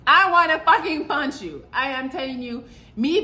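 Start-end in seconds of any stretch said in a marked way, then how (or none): 0.1-1.6 louder furious threat. Real, not acted. Answering the period comeback, furious and louder.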